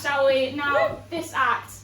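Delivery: normal talking voice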